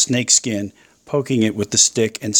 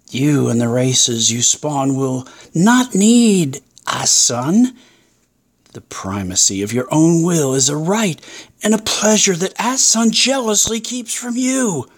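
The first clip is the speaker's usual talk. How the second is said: measured tempter, rising to a high pitch; tempting serpent; 6 semitones higher than usual. Acted. Serpent promises the pair independence, seductive and building. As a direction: build the temptation, climbing higher at the end. Snake tempter figure in story.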